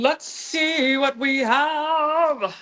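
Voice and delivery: slow, bright reveal; excited reveal. Real, not acted. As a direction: draw it out with excitement. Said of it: "Think good thoughts".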